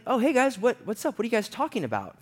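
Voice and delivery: high-pitched, breezy imitation of a buddy; casual questioning. Buddy walks in on friends talking, casual high-pitched asking.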